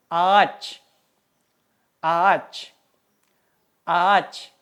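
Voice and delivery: "ağaç" with the ğ silent, one long "aa" before ch; silent soft g. Shown as a demonstration. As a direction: say "ağaç" as "aach", skipping the ğ. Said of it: "Cara bacanya itu tidak dianggap". ("ağaç" dibaca "aac", ğ tidak dibaca)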